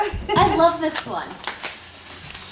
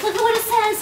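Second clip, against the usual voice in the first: high, rushing excitement; eager discovery; excited; 7 semitones higher, faster than usual. Real, not acted. Spots gift excitedly.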